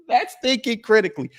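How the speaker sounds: high laughing sneer at the phrase; laughing mockery. Real, not acted. Streamer laughing scornfully at chat's critical thinking.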